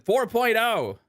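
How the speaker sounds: high-pitched mock British ad read, slipping toward Australian; put-on British accent. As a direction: read the sponsor copy in a hammed-up British accent. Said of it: "losing my accent".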